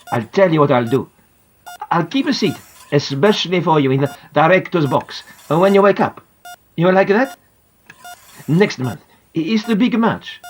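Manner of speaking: put-on Italian star bargaining with a sick boy; Italian accent. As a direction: kindly persuade with an Italian accent. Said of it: "I recognise his Italian accent".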